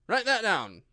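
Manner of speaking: a king scolding his subject; loud reproving royal voice; stern king. A narrator's reading. King commands jury.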